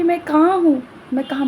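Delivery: high, frightened questions; panicked confusion. Acted. Girl waking in strange palace asks where she is, panicked. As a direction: wake up lost and alarmed.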